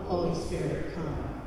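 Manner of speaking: ordinary talk, nothing marked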